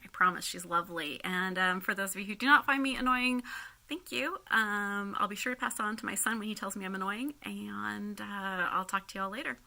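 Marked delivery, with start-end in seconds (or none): none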